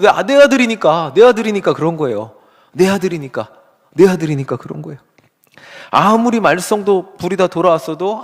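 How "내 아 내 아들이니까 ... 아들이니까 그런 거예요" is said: louder, pressing on 내 in each "내 아들이니까"; preacher stressing "내 아들이니까" to the congregation; insistent repetition. Acted. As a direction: hammer home "내 아들이니까" and lean on 내 every time. Insists on the reason.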